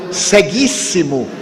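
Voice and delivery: ordinary talk, nothing marked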